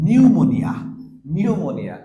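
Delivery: নিউমোনিয়া loud, opening with n, no p; pneumonia with silent p. Shown as a demonstration. Teacher to class: নিউমোনিয়া, loud, the p left silent. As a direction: belt out নিউমোনিয়া with no p. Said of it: "চলে আসি এখানে".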